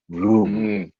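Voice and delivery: low imitated engine rev; low engine vroom. Recalling the car starting with a low vroom. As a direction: make the car's vroom sound low.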